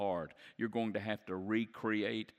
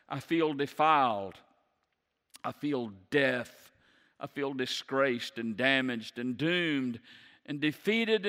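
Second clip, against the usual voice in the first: loud, high, building sermon climax; preaching at full force; 5 semitones higher, 7 dB louder than usual. Acted. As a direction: lift the congregation, pour it out.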